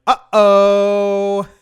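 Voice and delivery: loud, high, held-out uh-oh; big drawn-out high note; punchline uh-oh. Acted. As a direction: belt it out as the punchline. Punchline quoting company's statement.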